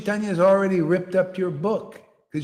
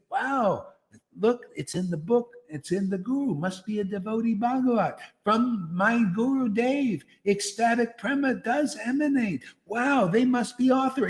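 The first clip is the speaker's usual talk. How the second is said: lightly voiced, higher-pitched amazed follower; mock awe; 5 semitones higher than usual. Preacher acts out a follower admiring a guru, lightly awed. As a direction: act out a touch of wide-eyed wonder.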